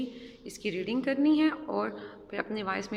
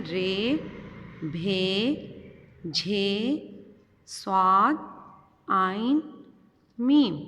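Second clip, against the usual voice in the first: letters called out louder, building to a loud last letter; letter drill; 4 dB louder, much slower than usual. Shown as a demonstration. Teacher reciting a Urdu primer line aloud for pupils. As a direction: drill each name with long pauses. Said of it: "दोबारा इस line की reading करते हैं".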